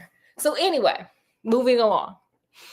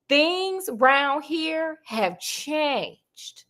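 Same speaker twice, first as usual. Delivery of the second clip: very slow, spooky warning; slow, dramatic foreboding; ominous warning; 3 semitones higher, much slower than usual. Acted. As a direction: drag it out like a dire omen.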